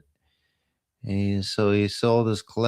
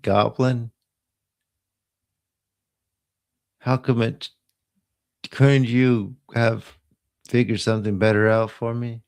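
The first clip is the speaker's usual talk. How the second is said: mock upset; 4 semitones higher than usual. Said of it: "I would be upset".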